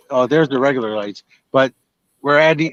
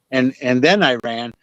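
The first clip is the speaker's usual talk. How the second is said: usual speaking voice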